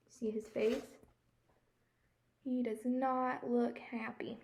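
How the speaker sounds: ordinary delivery, nothing marked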